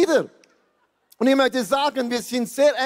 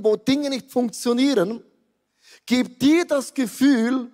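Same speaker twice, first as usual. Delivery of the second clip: normal talking voice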